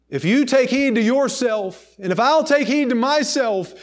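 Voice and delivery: full-force preaching. Real, not acted. Preacher urging each listener to take heed, full-force preaching.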